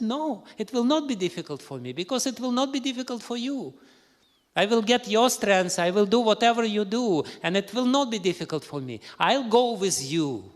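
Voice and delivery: high and loud, pleading resolve; passionate insistence. Acted.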